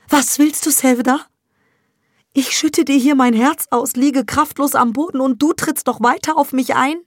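high, loud, hurt accusation; wounded mother. Acted. Mother accuses Sevda of kicking her, high and hurt. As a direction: cry out from the floor, hurt.

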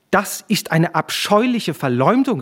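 indignant cry. A narrator's reading. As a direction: cry out in outrage at the slander. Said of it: "rief Veronika mit zornglühenden Augen". (zornig gerufen)

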